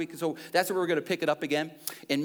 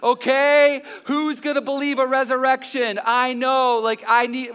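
annoyed Thomas; 5 semitones higher, 5 dB louder than usual. Retold or imitated. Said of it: "annoyed every single time going".

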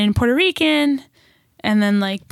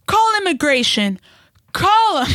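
higher-pitched impression of an immigrant mom's broken English; imitating mom's broken English; 8 semitones higher than usual. Daughter retelling mom's threat to call immigration, in mom's broken English. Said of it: "her broken ass English was like".

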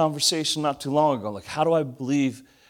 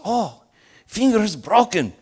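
put-on German doctor accent; 8 semitones higher, 4 dB louder than usual. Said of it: "old German doctor".

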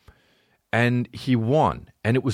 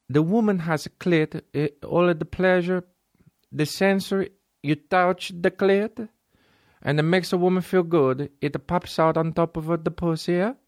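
put-on Italian accent; 9 semitones higher than usual. Italian voice in quoted dialogue.

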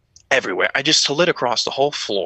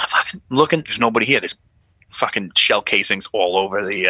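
ordinary talk, nothing marked

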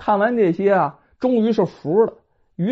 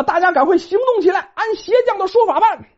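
尖高的女人腔急促下令; 急着催众人动手; 地主婆下令; 11 semitones higher, faster than usual. Acted. (地主婆尖声急令众人)

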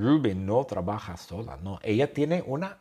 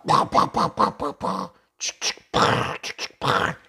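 unvoiced pa-pa-pa shooting noises; gun sounds; 2 semitones lower than usual. Storyteller making pistol noises for learners. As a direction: spit out little gunshot pops.

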